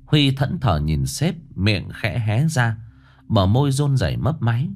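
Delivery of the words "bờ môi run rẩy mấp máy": plain reading voice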